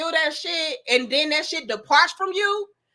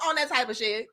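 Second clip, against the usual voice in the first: normal talking voice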